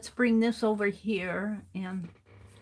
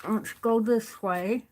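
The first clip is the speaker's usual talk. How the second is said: talking with pencil between teeth; pen in teeth, words muffled; pencil in mouth. Real, not acted. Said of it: "put my pencil in my mouth".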